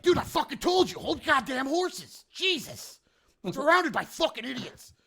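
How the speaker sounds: high, loud, acted outburst of exasperation; exasperated scolding. Hobgoblin boss to jumpy underlings, exasperated and loud.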